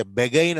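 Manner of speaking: బెగైన్ with "be" then "gain"; wrong spelling pronunciation. Shown as a demonstration. Teacher offering another absurd reading of begin, deliberate mispronunciation. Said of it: "అనం".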